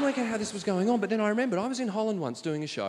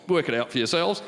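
dismissive; 6 semitones lower than usual. Retold or imitated. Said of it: "which is go".

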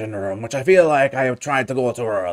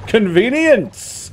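dramatic exclamation; 10 semitones higher, 3 dB louder, much slower than usual. Acted. Mock grand exclamation.

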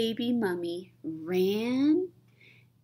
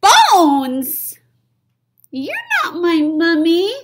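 put-on loud high little-kid cry; high loud child voice yelling Bones; surprised child shout; 10 semitones higher, 12 dB louder, slower than usual. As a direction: shout it in startled disappointment.

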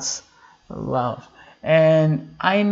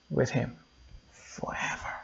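whispered; 3 semitones lower, 13 dB quieter than usual. Acted. Sermon hushed climax.

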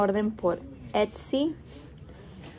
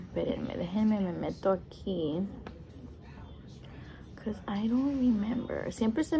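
quiet aside; 4 semitones lower, 10 dB quieter than usual. Real, not acted. Quiet aside while checking.

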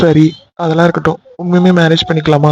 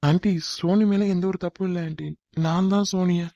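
much softer, pleading; timid plea; 12 dB quieter than usual. Acted. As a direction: plead meekly to an angry mother. Boyfriend pleads again.

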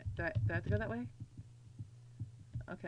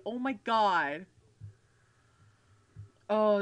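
normal talking voice

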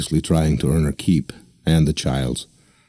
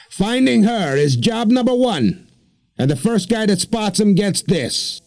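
high, slow preacherly showman voice; a lofty, drawn-out rallying call; deacon's voice. Acted. Deacon rallying the crowd, high and slow.

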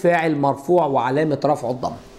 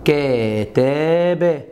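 kataba drawn out slowly for the class; slow dictation; 4 semitones lower, much slower than usual. Shown as a demonstration. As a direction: stretch كتب syllable by syllable. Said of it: "يقول لك ايه؟".

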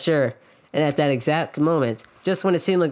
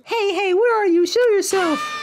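very high cartoon call; Usami shouting; slower than usual. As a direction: squeak out a challenge to someone hiding. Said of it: "Usami's shouting voice could be heard".

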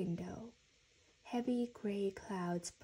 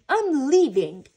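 high, very loud announcement; fed-up child's yell; angry outburst; 5 semitones higher, 17 dB louder than usual. A narrator's reading. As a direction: shout it before storming off. Franklin storms off in a temper.

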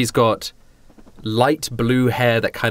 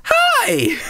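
very high, drawn-out greeting; perky young girl. Acted. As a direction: greet them in a high, bubbly girl's voice. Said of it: "She goes".

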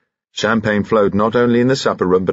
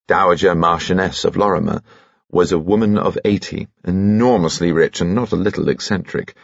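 plain reading voice

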